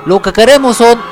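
villain demands. Acted. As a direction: announce the ransom like a movie villain. Villain states ransom demands. (exigencia de villano)

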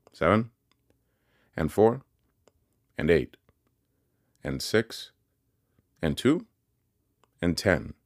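each figure tossed out as a prompt, long pauses between; quizzing. A narrator's reading. Pumblechook firing sums during breakfast, insistent quizzing. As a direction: pop each number up like a quiz question. Pumblechook fires running sum at boy.

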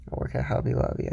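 hushed whisper repeat. Real, not acted. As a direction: drop to a breathy whisper to repeat it. Repeats the line, then whispers.

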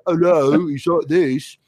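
lazy stoner dude from space; stoner alien. Acted. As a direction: alien stoner guest on the show. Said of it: "Neil from The Young Ones".

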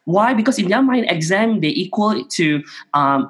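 ordinary talk

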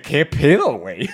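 baffled newcomer from the capital, hammed up; mock outraged Chilango. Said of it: "Llegué y dije".

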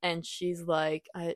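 normal talking voice

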